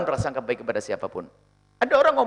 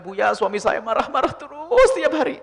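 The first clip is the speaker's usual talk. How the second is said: higher, slow, whiny complaint with a laugh; mock complaining wife; 8 semitones higher, 3 dB louder than usual. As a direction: ham up a wife's plaint. (istri mengadu ke Buya, merengek dibuat-buat)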